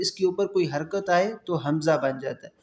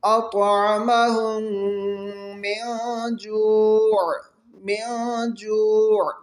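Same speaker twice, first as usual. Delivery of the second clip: slow, drawn-out tajweed chant; تلاوت کا انداز; Quran recitation; 3 semitones higher, much slower than usual. Shown as a demonstration. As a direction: stretch the long vowels like a reciter. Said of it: "خوب غنہ کر کے پڑھنا ہے".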